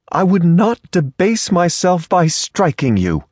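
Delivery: high, haughty rebuke; prim indignant lady. Acted. Offended lady scolds the stranger who grabbed her, high and haughty.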